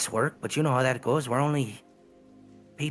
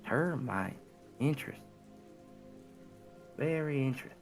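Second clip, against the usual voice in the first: put-on southern accent; 4 semitones higher, 10 dB quieter than usual. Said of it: "Wow, I went southern".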